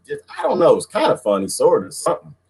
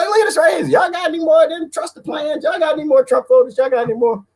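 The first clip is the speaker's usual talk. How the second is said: teasing shout. Real, not acted. Taunting viewers over Trump photos with high shouts.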